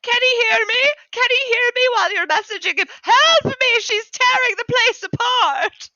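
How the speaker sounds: squeaky creature screaming for help; desperate cries for help. Acted. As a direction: yell for rescue like he's being torn apart.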